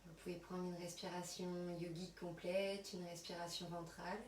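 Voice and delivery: soft, unhurried yoga nidra voice with long pauses; guided relaxation. Acted. Yoga teacher settling students on their mats, soft guided relaxation. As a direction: guide them quietly through body and breath.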